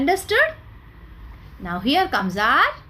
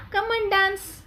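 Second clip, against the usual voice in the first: mock friendly invitation; 7 semitones higher than usual. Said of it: "we will call na".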